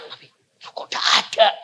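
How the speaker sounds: normal talking voice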